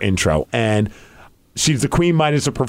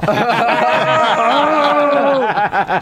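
big laugh; 9 semitones higher than usual. Real, not acted. Laughs at own joke.

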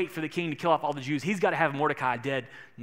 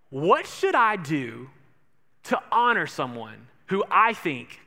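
slow sly question; 4 dB louder, slower than usual. Acted. King teasing Haman with question.

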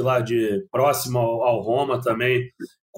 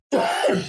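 a single harsh cough from the flu; coughing fit; 4 semitones higher than usual. Real, not acted. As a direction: cough hard, then clear the throat. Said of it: "desculpa mesmo pela tosse".

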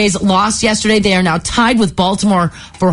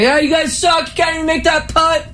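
higher jeering heckle; heckler; 9 semitones higher than usual. Retold or imitated. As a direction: taunt from the stands. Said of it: "decided to heckle him".

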